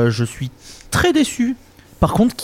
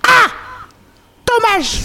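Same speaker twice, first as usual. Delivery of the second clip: high cawing crow voice; raspy cawing crow; slower than usual. Retold or imitated. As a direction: caw it out like a crow. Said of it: "avec cette voix".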